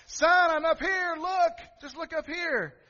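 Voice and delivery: high, loud call to a son below; father yelling from window; frustrated shouting father. Retold or imitated. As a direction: shout up at him, desperate. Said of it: "dramatic gestures, going".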